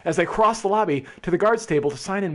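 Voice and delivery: ordinary delivery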